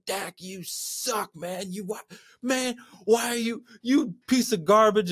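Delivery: drawn-out jeering at the quarterback, building to a yell; mock heckling. Angry fan heckling Dak from the stands, drawn-out then yelling. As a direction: boo him from the stands, then shout the insult.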